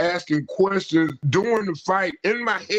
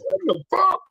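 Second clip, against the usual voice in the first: retold shocked outburst; 11 semitones higher than usual. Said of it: "And I was like".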